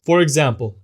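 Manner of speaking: usual speaking voice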